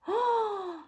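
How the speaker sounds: sharp storyteller's gasp; mock gasp. Reader fears for last goat, mock gasp. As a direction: gasp in suspense. Reader reacts to hungry troll.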